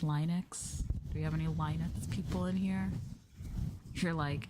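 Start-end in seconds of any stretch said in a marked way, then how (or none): none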